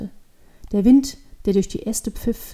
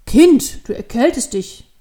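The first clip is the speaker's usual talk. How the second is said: sudden voice calling; 3 semitones higher, 8 dB louder, slower than usual. A narrator's reading. Unseen man warns the freezing devil girl, calling out. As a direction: call out to a child, abruptly. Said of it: "hört sie mit einem Mal eine Stimme".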